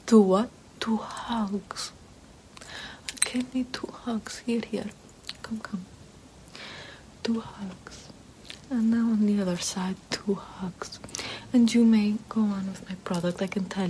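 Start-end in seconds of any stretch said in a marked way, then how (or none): 0.1-0.5 mock surprise. 0.8-11.0 tender whisper. Acted. Tender whispered hug request.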